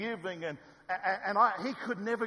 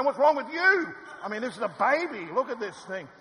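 louder, higher indignant yell; indignant shout back; 5 semitones higher, 7 dB louder than usual. Retold or imitated. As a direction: fling the question right back at him. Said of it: "And I go".

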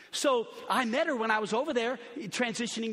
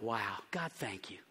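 quiet thanks; 12 semitones lower, 10 dB quieter, slower than usual. Retold or imitated. Private thanks to God.

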